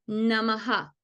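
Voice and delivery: "namaha" with the a repeated after the h; echoed vowel. Shown as a demonstration. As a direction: say namaha, echoing the a. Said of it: "tengo que mirar la vocal que está antes". (namaha, repitiendo la a final)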